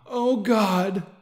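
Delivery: high, loud cry of pleasure; ecstatic cry. A narrator's reading. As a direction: cry it out, overwhelmed. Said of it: "I cry out".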